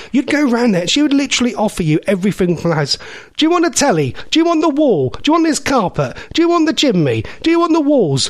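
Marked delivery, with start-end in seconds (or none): 3.4-8.3 very high, relentless rising-and-dropping offers; over-generous nanny. Retold or imitated. Nanny offering grandchild the whole house, high insistent voice. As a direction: reel off offers in a high nan voice.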